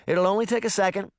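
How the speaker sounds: high, quick, apologetic reply; lightly voiced harried young woman, high and fast; placating princess. A narrator's reading. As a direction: hurriedly placate an impatient customer. Princess placates impatient customer.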